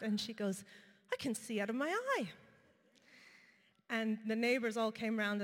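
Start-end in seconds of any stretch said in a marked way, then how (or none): 1.1-2.3 higher-pitched mock cry of astonishment; imitated excited scream. Old woman suddenly able to see, imitated excited cry. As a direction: act out a woman crying out, without a full scream. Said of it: "this old woman starts to scream out".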